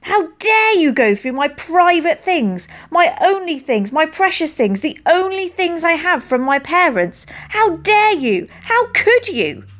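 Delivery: high, loud, slow enraged shouting; furious. A narrator's reading. Girl raging at friend who took her letter, furious. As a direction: rage pouring out, voice climbing.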